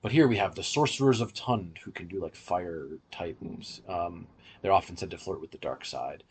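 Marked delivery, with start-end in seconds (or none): none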